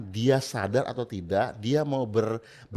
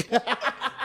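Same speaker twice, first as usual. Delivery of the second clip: high laugh; 12 semitones higher than usual. Real, not acted. Laugh after joke about dying.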